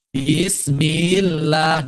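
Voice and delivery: "Bismillah" very slow, each syllable held; over-lengthened syllables. Shown as a demonstration. Teacher imitates a common Indonesian misreading, "Bismillah" dragged out. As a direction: draw out every syllable of "Bismillah". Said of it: "orang yang suka baca begitu".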